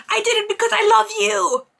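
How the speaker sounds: high-pitched melodramatic cry; hammed-up confession. Retelling her confessing love to him, melodramatic wail. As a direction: blurt it out as a mock lovelorn outburst.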